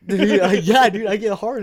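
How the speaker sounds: loud, high, laughing through words; cracking up while talking. Real, not acted. Laughing at his own story.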